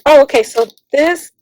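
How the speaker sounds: ordinary talk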